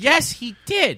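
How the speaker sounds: high, loud insistence; insistent contradiction. Real, not acted. Insists in a mock quarrel.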